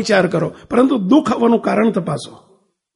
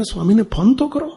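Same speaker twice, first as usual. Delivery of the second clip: ordinary talk, nothing marked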